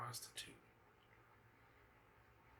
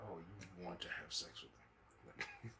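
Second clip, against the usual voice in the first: knowing mutter; 9 semitones lower, 8 dB quieter, slower than usual. Retold or imitated. Voicing what women think.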